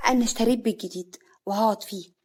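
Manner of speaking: ordinary delivery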